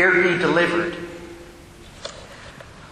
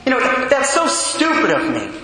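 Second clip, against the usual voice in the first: higher, scornful put-down of herself; harsh self-scolding tone; 5 semitones higher than usual. Retold or imitated. Penitent woman telling priest her faults, imitated harsh self-scorn. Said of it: "And just this tone".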